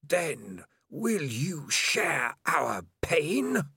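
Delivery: very slow, menacing, drawn out; dramatic hiss. Acted. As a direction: savour the threat slowly. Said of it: "sharp and loud and dramatic".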